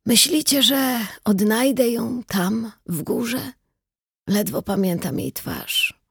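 put-on slow, high frail old voice; wistful dying old man. A narrator's reading. (słaby głos umierającego starca)

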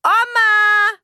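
loud high yell through the house; mother bellowing. A narrator's reading. Mother yelling for the family, full loud shout. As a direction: bellow the names across the house. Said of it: "brüllte meine Mutter".

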